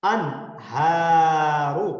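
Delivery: انہارو drawn out with a clear n; recited very slowly. Shown as a demonstration. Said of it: "آپ میرے ساتھ پڑھیں". (انہارو صاف نون کے ساتھ بہت آہستہ)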